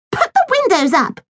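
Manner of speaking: put-on high, bossy little girl shouting; girl's shout. A narrator's reading. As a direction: snap it out like a spoilt girl. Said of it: "shouted Millie".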